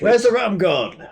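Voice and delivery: lightly doing Captain Jack; Jack Sparrow impression. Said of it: "Jack Sparrow".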